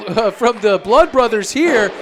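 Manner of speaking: ordinary delivery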